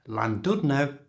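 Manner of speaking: Llandudno with an ordinary English l; Llandudno, simple l at the start; plain L sound. Shown as a demonstration. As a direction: Llandudno made easy with a normal l. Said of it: "fine just to use a normal L sound".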